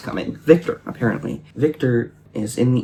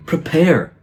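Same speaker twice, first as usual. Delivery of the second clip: loud, higher-pitched mock proclamation; exclaimed command; 5 semitones higher, 5 dB louder than usual. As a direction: proclaim it like a dramatic written warning. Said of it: "exclamation point".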